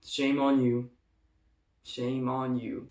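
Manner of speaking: low, soft reproach; quiet scolding. Acted. Victim addressing the laser thief, low quiet reproach. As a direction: drop your voice to shame them.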